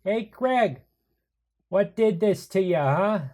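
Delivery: loud, high holler to someone; calling out. Acted. Arden leans under and hollers to Greg, calling out. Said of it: "Arden leans under again and says".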